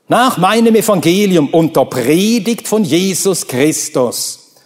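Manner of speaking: ordinary talk, nothing marked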